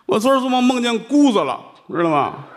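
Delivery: high, plaintive excuse; whiny seller. Acted. Vegetable seller moaning to the nuns about his bad dream, high and plaintive.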